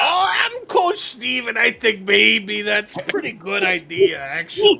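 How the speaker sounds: high, loud laughing cartoon coach; Coach Steve voice. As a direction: ham up a goofy coach. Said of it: "Oh, the coach".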